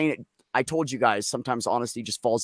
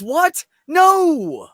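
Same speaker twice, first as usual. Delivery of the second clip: high, loud startled denial; indignant what, no; shocked child; 14 semitones higher, 9 dB louder than usual. Retold or imitated. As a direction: blurt it out, taken aback.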